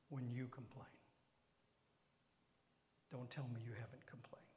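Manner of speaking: soft unvoiced whisper; hushed knowing challenge. Real, not acted. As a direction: tease them in a whisper.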